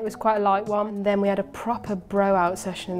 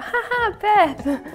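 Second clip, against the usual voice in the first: high, put-on ha-ha, then the name; high-pitched mock laughter while calling out; imitated laughing; 12 semitones higher, 3 dB louder, much slower than usual. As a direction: act out laughing at a friend. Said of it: "I'm literally laughing like".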